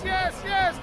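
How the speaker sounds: high shouted yes, falling at the end; excited yes. Real, not acted. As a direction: yell it as a cheer. Cheering the climb.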